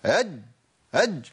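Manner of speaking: jab the jeem in أج; harsh jeem. Shown as a demonstration. Teacher mocking a violent jeem. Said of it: "خلي الامور سهلة كده".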